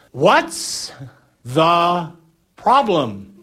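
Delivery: comically stretched shouted reply; yelling back. Acted. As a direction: holler it slowly back through a storm. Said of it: "yelling back, something like this".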